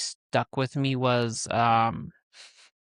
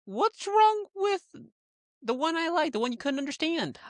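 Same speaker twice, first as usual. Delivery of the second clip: indignant protest. Real, not acted. Defending a favourite suitor to the co-host, indignant protest. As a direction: protest in a much higher voice.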